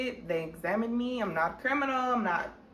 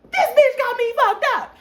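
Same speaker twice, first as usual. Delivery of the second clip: much higher and louder furious outburst; outraged shout; 10 dB louder than usual. Retold or imitated. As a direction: blow up at the friend who set you up. Outraged at friend.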